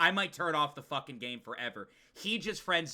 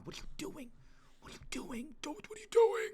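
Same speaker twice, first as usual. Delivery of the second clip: urgent hushed whisper, far softer; scolding whisper; 10 semitones higher, 9 dB quieter than usual. Real, not acted. Streamer telling off chat behind YouTube's back, urgent whisper. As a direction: whisper an exasperated aside.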